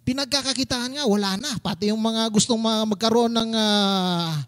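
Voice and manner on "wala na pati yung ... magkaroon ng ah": ordinary talk, nothing marked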